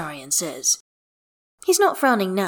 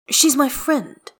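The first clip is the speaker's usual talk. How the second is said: higher, drawn-out defensive retort; snappish; 9 semitones higher, 6 dB louder, slower than usual. A narrator's reading. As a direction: insist slowly, a touch testy.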